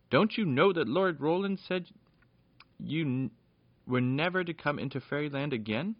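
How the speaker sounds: high, scolding gatekeeper; the gatekeeper scolds the children, outraged; indignant. A narrator's reading. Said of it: "he said indignantly".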